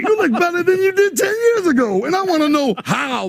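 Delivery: high-pitched playful disbelief; mock amazement. Host hyping the guest's youthful looks, mock amazement. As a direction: marvel at him in comic outrage.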